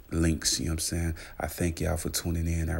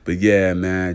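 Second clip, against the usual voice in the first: voiceless laugh into loud yeah; 6 dB louder, slower than usual. Real, not acted. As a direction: laugh it off, then carry on. Laughing at his wife's photo.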